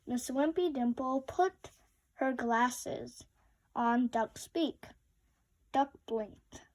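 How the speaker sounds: usual speaking voice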